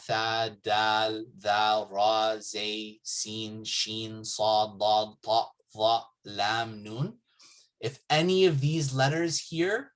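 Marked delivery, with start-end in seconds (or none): none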